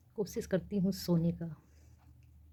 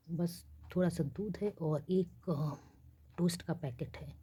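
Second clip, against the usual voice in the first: usual speaking voice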